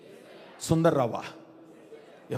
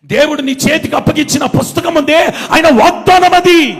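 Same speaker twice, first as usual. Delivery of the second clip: high, loud, building proclamation; full-force preaching; 6 semitones higher, 11 dB louder than usual. Real, not acted. Preacher declaring scripture as God's promise, shouted preaching. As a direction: declare it at a shout, stretching the end.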